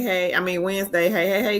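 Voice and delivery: ordinary talk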